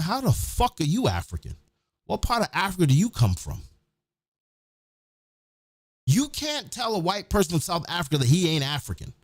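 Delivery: ordinary talk, nothing marked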